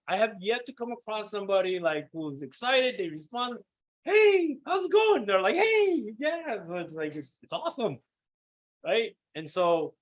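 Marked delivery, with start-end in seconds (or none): 4.0-5.2 much higher, loud chirpy greeting; fun excited voice. Retold or imitated. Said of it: "they put on the fun voice too". 5.6-6.6 much higher bright hey; fun excited voice. Retold or imitated. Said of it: "they put on the fun voice too".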